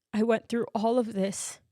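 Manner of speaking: usual speaking voice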